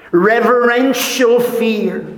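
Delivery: high and loud, ringing out each word; emphatic proclamation. Acted. Preacher stresses to congregation the fear of God, emphatic proclamation. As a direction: declare it from the pulpit.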